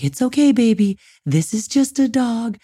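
high, soothing, slow reassurance; calm the baby while hiding your own fear; calm baby talk. Shown as a demonstration. Parent soothing baby as strange dog approaches, high calm baby talk. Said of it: "you have to say things like".

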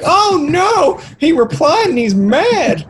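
high squealing mock panic. A troll panicking after an angry reply, high squealing mock panic. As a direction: shriek it like a startled troll.

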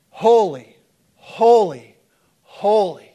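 three separate loud cries, pauses between; loud, weighty, falling exclamations; emphatic repetition. Acted. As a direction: proclaim each word on its own. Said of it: "They'd repeat the word".

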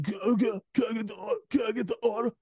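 put-on high-pitched drunken pestering; mock drunk ordering. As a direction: imitate your hammered self hassling the drive-through. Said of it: "I was hammered".

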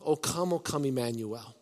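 softly recalling the hymn line; soft hymn quote. Retold or imitated. Pastor quoting the carol to the church, softly. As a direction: let the hymn words fall quiet. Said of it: "we just sang in the song".

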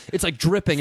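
ordinary talk, nothing marked

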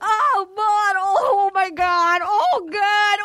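high, sobbing, shrieking joy; mock prize-winner hysteria. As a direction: gush like a mom who won the jackpot. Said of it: "this is the Publishers Clearinghouse".

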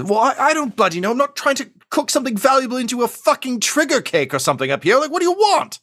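squeaky, indignant goblin cook pleading not to die; Chef Goblin Ramsay. Acted. Goblin chef answers the rebels on the comms, high and indignant. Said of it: "he says".

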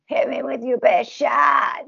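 raspy, slow rock shout; gritty belted rock line, slow and raspy. Shown as a demonstration. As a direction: growl it out like an eighties rock singer. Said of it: "my voice is not naturally as raspy as [name]'s".

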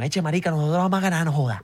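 light, fast costeño caricature; co-host plays Colombian coast fans boasting; Colombian costeño accent. Pretend. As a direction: brag like a coastal Colombian fan. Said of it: "Y salen unos costeños".

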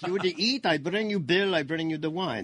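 mock-indignant Italian waiter accent. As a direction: play a blunt Italian waiter. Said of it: "his Italian accent".

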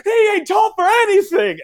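high, slow, shrill-pitched yell from the stands; screaming heckler. Retold or imitated. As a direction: bellow it like an angry parent in the crowd. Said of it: "this parent behind me kept screaming".

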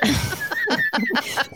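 sudden high-pitched laugh; high burst of laughter. Real, not acted. Amused by the hypnotized joke, a high laugh.

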